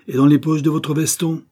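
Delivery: loud, high accusing question; pressing demand. A narrator's reading. Martin demanding monsieur Ford search his jacket, menacing.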